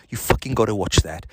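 ordinary talk, nothing marked

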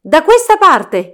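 loud, high, drawn-out call. A narrator's reading. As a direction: shout directions to fellow hunters. Prince calls out during the chase.

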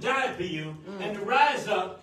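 fiery sermon climax, loud and slowing; full-force preaching. Acted. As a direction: preach it home, building to a shout.

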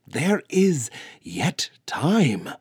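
slow, loud, high defiant retort; shot back. Acted. Brona defying the elders, a slow loud retort. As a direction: fire it back, each word weighed. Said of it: "Brona shot back".

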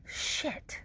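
voiceless, hushed swear; panicked aside under the breath; whispered curse. Retold or imitated. Retold panicked thought, whispered.

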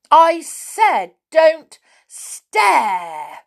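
very slow, high, loud repeat; exasperated cry. A narrator's reading. Connie repeating herself to a deaf man, loud and exasperated. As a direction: drive each word home loudly. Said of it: "cried Connie".

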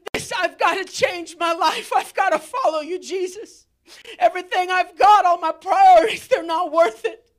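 loud, fast shouted vow; desperate shout. Acted. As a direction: cry out the decision to change. Voicing repentant listener crying out.

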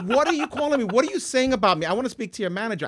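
high, playful outrage at the waiter; mock offended. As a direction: act insulted and demand satisfaction, half joking. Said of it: "I'm gonna take offense to it".

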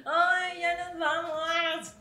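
high, drawn-out mock wail; fake-cry the goodbye, loud and slow; lloriqueo fingido. Lamento fingido por el cierre. (lamento agudo de llanto fingido)

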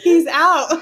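mock excited mom. Mock mother, child walks off.

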